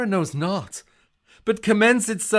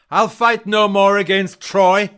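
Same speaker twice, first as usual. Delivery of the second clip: defiant declaration; 8 dB louder than usual. Acted. Achilles refuses to fight.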